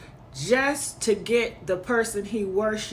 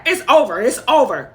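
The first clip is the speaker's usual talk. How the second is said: loud mock gloating, echoing the pundit; mocking them; 3 semitones higher, 9 dB louder, faster than usual. Mocking the pundits' victory call, loud and gloating. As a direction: throw their own words back loudly, mockingly. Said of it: "this same energy that they have".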